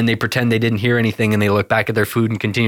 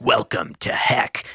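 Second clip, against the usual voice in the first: greeter voice; 2 semitones lower, slower than usual. Retold or imitated. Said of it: "the guy's like".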